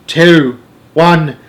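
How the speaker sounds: anxious, loud countdown, word by word; alarmed cry. A narrator's reading. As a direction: count down in mounting dismay. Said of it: "Missus Buckets cried".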